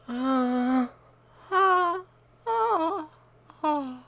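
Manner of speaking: high, loud ah calls. Pretend. Loud calls testing echo.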